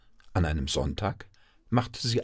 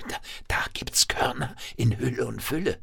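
hushed, breathy wonder; half-whispered excitement; excited whisper; 5 semitones higher than usual. A narrator's reading. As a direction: whisper it like a thrilling secret. Mouse reveals grain treasure.

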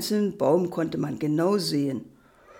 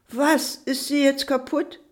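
higher, mildly shocked father; dismayed question; 7 semitones higher than usual. A narrator's reading. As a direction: ask in raised-pitch disbelief. Vater erfährt vom kaputten Spielzeug. (leicht entsetzte, höhere Frage)

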